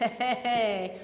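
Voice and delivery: low-key, soft flirty "hey"; mock ogling. Someone eyeing an attractive person, mock flirty. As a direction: play at eyeing someone up, softly. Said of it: "You've looked at someone and been like".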